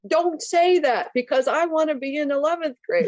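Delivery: mock petulant protest, pitched high; high-pitched, put-on indignation; whiny objection. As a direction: imitate a client whining back. Imitates client objecting to second grade.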